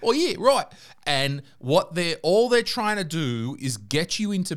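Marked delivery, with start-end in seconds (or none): 0.0-0.6 mock Australian charmer, higher and louder; hammed-up Aussie backpacker, laughing along; imitating an Australian guy flirting in a hostel, hammed-up Australian accent; Australian bloke. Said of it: "there's some Australian".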